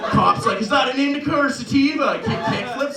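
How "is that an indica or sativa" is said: high, loud acted question; hammed-up commercial cop; cop calling out. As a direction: shout it across the skate park.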